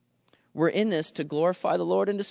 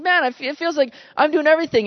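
higher, faster fed-up whine; grumbling complaint; 9 semitones higher, 5 dB louder, faster than usual. Retold or imitated. Preacher retelling his own stinky attitude, whiny. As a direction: moan that you do everything. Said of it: "I think".

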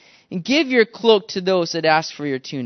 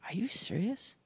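hushed, incredulous whisper; stunned soldier; 14 dB quieter than usual. Acted. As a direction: whisper it in disbelief. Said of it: "his reaction to that".

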